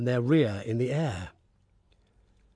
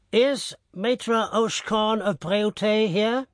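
gendarme voice; 7 semitones higher, slower than usual. Acted. Gendarme corporal asks.

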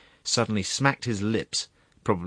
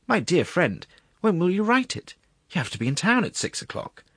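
high-pitched pleading objection; dismayed protest; 10 semitones higher than usual. Acted. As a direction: plead with him, voice jumping up. Secretary protests as others leave.

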